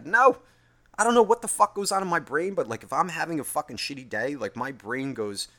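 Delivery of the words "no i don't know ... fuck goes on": sharp high shout of no; loud high no. Real, not acted. Refusing his own happy thoughts, a sudden high shout.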